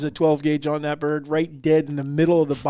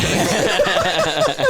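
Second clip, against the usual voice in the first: imitated laugh; 6 semitones higher, 4 dB louder than usual. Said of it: "And my dad's like".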